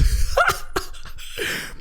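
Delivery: breathless, squeaky laugh; shrieking silent laugh; high wheezing laugh. Real, not acted. Laughing at Dingleberries.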